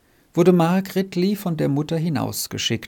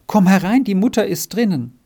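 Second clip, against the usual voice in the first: higher, louder urging; urgent invitation; 6 semitones higher, 5 dB louder than usual. Acted. Margretli urging Renz to come into the house, eager and raised. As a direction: eagerly call him inside.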